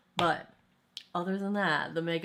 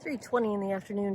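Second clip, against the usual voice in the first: ordinary delivery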